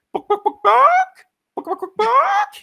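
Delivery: high squawking bawk calls; mock chicken bawk. Comic hero taunting hiding dealer with chicken squawks. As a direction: cluck like a chicken to taunt him. Mocking chicken call, imitated.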